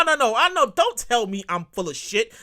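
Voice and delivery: ordinary talk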